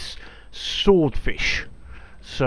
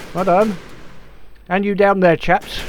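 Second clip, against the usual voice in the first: loud, higher officer's cheer; calling out praise; 5 semitones higher, 6 dB louder than usual. Pretend. Captain calling praise to crew below deck, shouting loudly.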